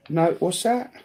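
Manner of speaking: ordinary talk, nothing marked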